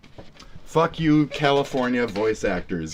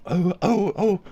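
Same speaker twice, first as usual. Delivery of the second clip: soft, higher, gentle bumbling bear; Winnie-the-Pooh voice; 6 semitones higher, 4 dB quieter than usual. Shown as a demonstration. Streamer demonstrating a bear calling Christopher Robin, soft character voice. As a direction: say it softly like a storybook bear. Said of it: "I've been doing this one".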